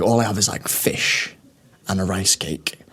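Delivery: deadpan parody of his diet; imitating video guy. Mimicking gym video guy.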